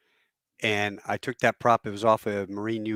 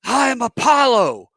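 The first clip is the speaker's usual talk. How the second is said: mock outraged shout, high and loud; pissed-off astronaut; 13 semitones higher, 9 dB louder, slower than usual. Astronaut correcting a man who took him for shuttle crew, pissed off. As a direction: bellow it in offended disbelief. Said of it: "he got pissed".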